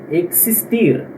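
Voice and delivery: "existir" said "ek-sis-TEER"; X as in táxi. Shown as a demonstration. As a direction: sound the x of "existir" as ks. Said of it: "ele é pronunciado dessa forma".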